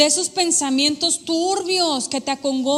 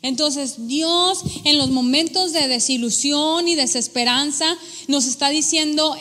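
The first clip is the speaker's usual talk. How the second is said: usual speaking voice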